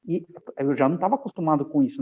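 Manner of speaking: ordinary delivery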